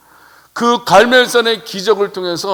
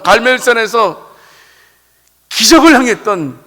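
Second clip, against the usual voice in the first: a preacher at full voice; rising sermon fervor; 3 dB louder than usual. Acted. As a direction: build the praise louder line by line. Preaching builds on Elijah's triumph.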